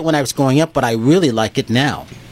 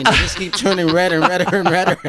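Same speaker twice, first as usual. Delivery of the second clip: laughing; 4 semitones higher than usual. Real, not acted. Laughing about Santa's red cheeks.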